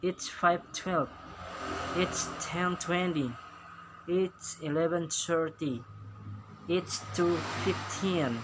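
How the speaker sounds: slow, careful choral lead; slow drill reading. Shown as a demonstration. Teacher leads pupils reading American times slowly. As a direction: set a slow pace for the class to follow. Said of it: "Sekarang American style".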